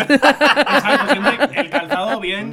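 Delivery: slow, laughing emphasis; drawn-out laughing agreement. Real, not acted. Joking reply, laughing.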